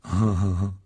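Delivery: short mocking snicker; sneering laugh. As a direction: laugh at the Weasleys. Malfoy mocking the Weasleys.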